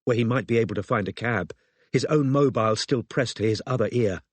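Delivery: ordinary delivery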